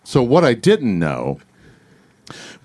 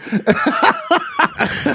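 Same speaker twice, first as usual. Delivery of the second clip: pitched-up helpless laugh; high laugh. Real, not acted. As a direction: laugh high at own story. Laughing while telling story.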